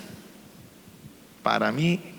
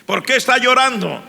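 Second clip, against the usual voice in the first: much higher, louder demanding question; insistent teacher; 11 semitones higher, 4 dB louder than usual. Retold or imitated. Teacher presses the crying student in class, high and loud. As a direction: press for an answer, alarmed.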